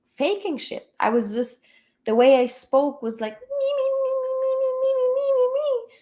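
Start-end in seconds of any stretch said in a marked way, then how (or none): 3.3-5.9 very high, sing-song "me, me, me"; much higher, thin, people-pleasing singsong; weird meek voice. Retold or imitated. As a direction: chirp it like a fake-sweet vocal warm-up. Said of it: "in this, like, weird voice".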